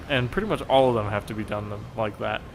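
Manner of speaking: usual speaking voice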